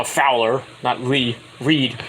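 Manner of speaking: ordinary talk, nothing marked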